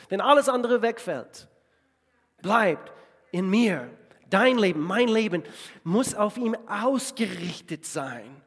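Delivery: high, loud, slowed on bleibt in mir; preaching climax. Real, not acted. Preacher calling the congregation to remain in Jesus, climactic preaching. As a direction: hammer home the call to remain.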